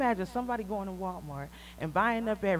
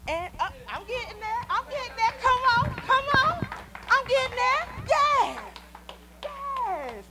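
much higher and louder, fired up; excited preaching; 6 dB louder than usual. Real, not acted. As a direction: rise up with rousing excitement. Preacher building to a point.

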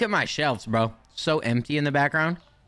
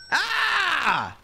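exasperated; 13 semitones higher than usual. Real, not acted. Exasperated at complaining customers.

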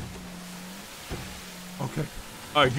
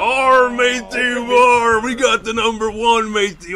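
loud, higher mock pirate whoop; excited pirate cheer; 5 semitones higher, 6 dB louder, slower than usual. Pirate crows loudly after catching the top fisherman. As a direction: crow like a pirate over his prize.

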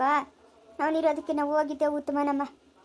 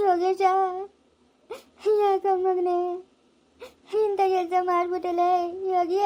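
sobbing reproach to the dead; wailing lament; 3 semitones higher than usual. Acted. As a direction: cry out to your dead child. Mother wails to her dead son.

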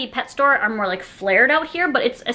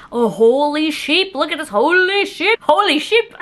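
sheep voice; 3 semitones higher, 4 dB louder than usual. Pretend. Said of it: "He's like".

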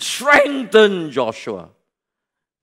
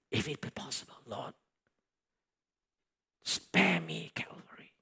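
soft breathy whisper, lower; whispering prayer of surrender; whispered plea; 4 semitones lower, 18 dB quieter than usual. Acted. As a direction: plead in a broken whisper. Jesus begging softly.

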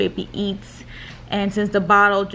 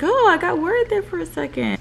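relieved doctor quote; 9 semitones higher than usual. Retold or imitated. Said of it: "She was like".

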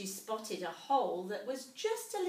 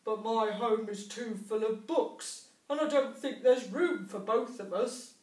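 mumbling mole; 2 semitones higher, 8 dB louder than usual. A narrator's reading. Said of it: "mumbled Mole".

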